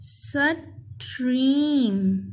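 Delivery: Stream as "स", pause, long "trim"; split into parts. Shown as a demonstration. Teacher showing how to spell, stream split in two. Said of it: "वैसे अलग-अलग करके पढ़ें".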